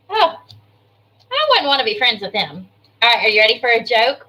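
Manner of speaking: ordinary talk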